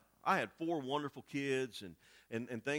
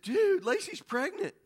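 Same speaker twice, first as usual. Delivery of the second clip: tearful announcement; 6 dB louder, slower than usual. Retold or imitated. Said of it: "And I'm just sobbing".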